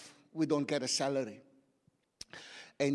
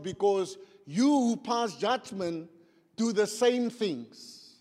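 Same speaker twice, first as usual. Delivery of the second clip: slow, raised, ringing delivery; emphatic scripture reading; 5 semitones higher, 8 dB louder, slower than usual. Real, not acted. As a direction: declaim the verse with long drawn-out words. Reads scripture aloud to congregation.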